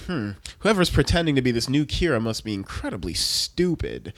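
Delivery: low, soft, pondering voice; mock detective musing, kept low and quiet; lightly acted deep thinker. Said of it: "And then L's like".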